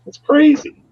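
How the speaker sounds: high, squeaky disbelief; mock astonishment. Reacting to a friend home after surgery, high mock disbelief. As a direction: play it utterly baffled. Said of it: "I'm like".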